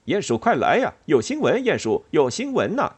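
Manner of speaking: high, loud fieldmouse call; excited little mouse calling out; excited shouting. A narrator's reading. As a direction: holler the big news urgently. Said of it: "他喊道".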